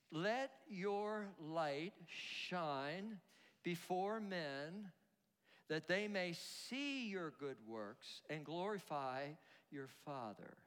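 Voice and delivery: slow, measured verse reading; simple scripture quote. Retold or imitated. Preacher quoting Jesus from verse sixteen, slow and simple. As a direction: let each phrase land slowly, softening at the end. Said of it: "he says simply".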